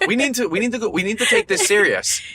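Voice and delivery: high mock-earnest wife voice; imitating wife, high. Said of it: "And she goes".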